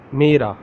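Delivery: Mera said clearly as "meh-ra", tapped r; Urdu word. Shown as a demonstration. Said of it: "How to say in original Urdu?".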